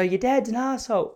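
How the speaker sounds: high, blunt mum voice; mock mum. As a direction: say it like a mum who doesn't care who hears.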